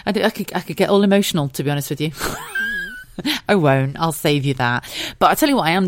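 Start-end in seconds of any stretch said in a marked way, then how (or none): 2.2-3.4 high embarrassed giggle; a shy little laugh. Real, not acted. Laughing off emotion.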